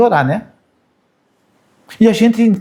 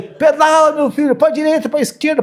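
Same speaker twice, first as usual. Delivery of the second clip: calling out to son; 8 semitones higher than usual. Retold or imitated. Father calling to son on bike.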